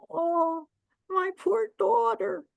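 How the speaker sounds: high, slow lament of a mother; grieving old woman. Acted. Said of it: "here's the old woman".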